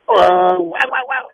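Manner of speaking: high, loud yapping bark; loud high dog bark. Acted. Dog barks again.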